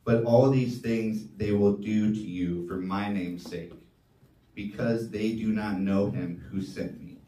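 normal talking voice

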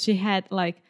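ordinary talk, nothing marked

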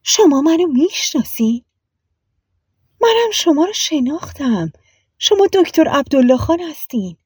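high, wondering recognition; astonished. A narrator's reading. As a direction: realize who he is with amazement. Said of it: "زری حیرت زده به اون نگاه کرد و پرسید".

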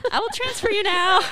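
high, loud, hammed-up phone announcement; mock phone panic. Agent handing off a screaming caller, loud mock panic. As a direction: sing it out loudly over an angry caller.

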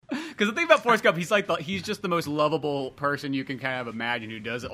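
ordinary delivery

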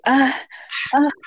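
short, high mock moans; imitated moan. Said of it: "I was fucking moaning".

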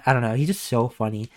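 ordinary delivery

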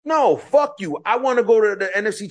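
a sudden heated no; emphatic outburst. Real, not acted. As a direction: fire it back in disbelief. Heated football argument rant.

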